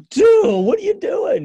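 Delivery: high, loud incredulous cry; shocked yell. Retold or imitated. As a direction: shriek it out in disbelief at a friend. Friends shocked at snowball throw.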